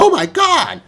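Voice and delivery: high, startled cry of shock; a shocked onlooker after a customer's outburst; shocked gasp. Retold or imitated. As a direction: gasp it out like a stunned bystander.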